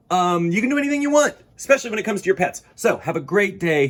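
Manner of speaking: high, loud, fast excited encouragement; enthusiastic. Real, not acted. Enthusiastic sign-off.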